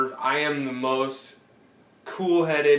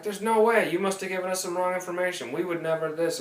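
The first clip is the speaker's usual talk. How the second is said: indignant rep; 7 semitones higher, faster than usual. Retold or imitated.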